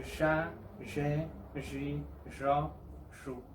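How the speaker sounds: "ya, ye, yi, yo, yu" with the Argentine zh sound; buzzing zh at the start of each syllable. Shown as a demonstration. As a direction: Rioplatense "ya, ye, yi, yo, yu". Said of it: "suena similar al sonido del doble L en Argentina".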